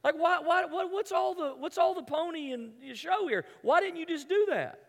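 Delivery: mock indignant complaint. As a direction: whine at Jesus like an impatient disciple. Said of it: "And you go".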